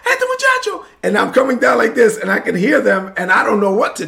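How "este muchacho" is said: high-pitched frantic yell from behind; friends yelling. Retold or imitated. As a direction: shout a warning in Spanish as he speeds downhill. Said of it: "my friends yelling in the background".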